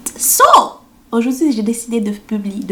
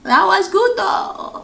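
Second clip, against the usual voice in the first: high, long-drawn oohs; mock-amazed, sustained high cooing; imagined awed reaction; 12 semitones higher, much slower than usual. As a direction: act out delighted wonder, much higher and slower. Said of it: "Je vais faire".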